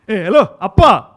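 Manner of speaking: loud, bright hello to the camera; big proud Appa greeting; cheerful dad intro. Shown as a demonstration. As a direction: announce yourself as dad with gusto. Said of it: "You see, it works".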